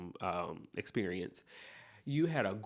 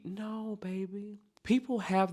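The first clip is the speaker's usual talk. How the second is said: gentle correction; 11 semitones higher than usual. Acted. Corrects the imagined worrier.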